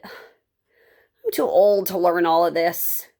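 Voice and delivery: put-on slow, weary old man giving up; discouraged grandfather, slow and heavy; frustrated grandfather. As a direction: drag it out in frustration. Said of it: "He closed the book in frustration".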